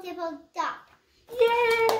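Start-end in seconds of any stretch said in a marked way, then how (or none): 1.3-1.9 cheer. Real, not acted. Cheering the child.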